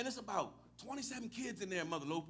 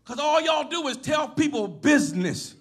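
raised, loud pulpit delivery building to a shout; full-force preaching; 8 semitones higher, 21 dB louder than usual. Real, not acted. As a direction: call out the congregation with rising force.

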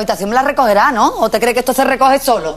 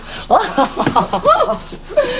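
high, teasing laughter; hooting laugh; 9 semitones higher than usual. Acted. Mother joking with her embarrassed child, hooting laugh. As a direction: hoot with laughter at your joke.

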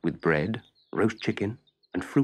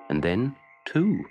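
ordinary delivery, nothing marked

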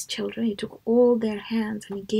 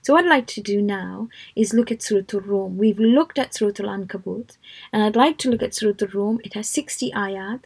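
usual speaking voice

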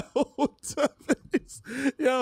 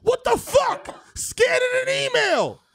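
high, loud outraged yelling; angry shouting; 14 semitones higher, 5 dB louder than usual. Pretend. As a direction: scream like a furious boss. Impersonating Taffer yelling.

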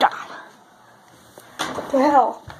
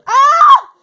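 sudden high loud yelp; startled scream; 12 semitones higher, 10 dB louder than usual. Pretend. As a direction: jump and scream. Toy startled by cows.

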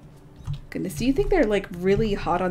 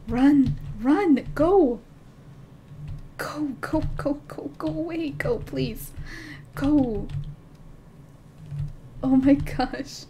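being chased; 7 semitones higher, slower than usual. Real, not acted. Wolves chasing her wolf.

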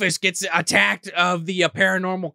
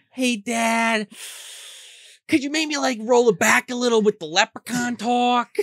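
higher, timid pleading; nervous mom; 7 semitones higher than usual. Retold or imitated. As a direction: gently ask dad to stop. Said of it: "Mom's getting a little nervous".

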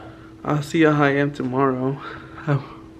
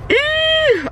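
nervous high-pitched squeal; 4 dB louder than usual. Real, not acted. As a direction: squeal out the nerves. Nervous squeal before surgery.

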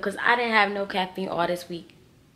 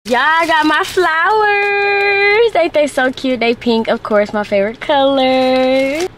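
squealing over flowers; 7 semitones higher, 6 dB louder, slower than usual. Real, not acted. Gushing over her new flowers.

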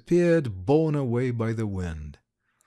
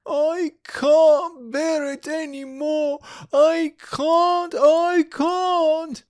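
very high, drawn-out wail; wailing child; 4 dB louder, slower than usual. A narrator's reading. Little girl at the end of her patience on the journey, wailing. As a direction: wail at the top of a child's range. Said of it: "filled the room with an unpleasant wailing".

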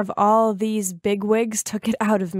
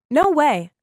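loud, higher refusal; yelled; 5 semitones higher, 5 dB louder than usual. Acted. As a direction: shout it out in defiance. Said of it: "I yelled".

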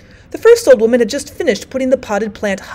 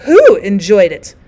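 loud challenge; 7 dB louder, slower than usual. Acted. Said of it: "said the woman in bed".

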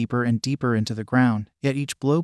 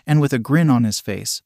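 usual speaking voice

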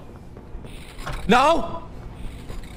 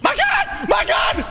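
panicked shout; 4 dB louder, slower than usual. Real, not acted. Cornered by monsters in game.

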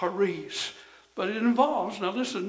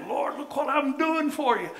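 proud boast; 8 semitones higher, 5 dB louder than usual. Retold or imitated. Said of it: "get puffed up sometimes ... and say".

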